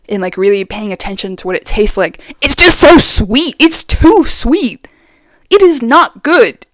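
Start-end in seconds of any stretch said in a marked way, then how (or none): 2.4-6.7 slow, higher, stretched-out complaint; dramatic slow protest at the sugar; emphatic disgust. Real, not acted. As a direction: drag out every word of the verdict. Emphatic verdict on sweet tea.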